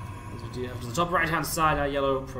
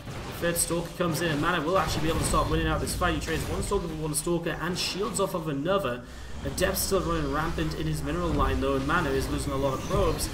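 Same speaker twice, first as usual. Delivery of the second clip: lively, raised esports commentary; live play-by-play; 3 semitones higher, 3 dB louder than usual. Acted. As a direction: call every trade as it lands. Casting a heated fight.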